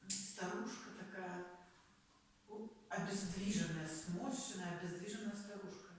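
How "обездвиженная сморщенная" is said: normal talking voice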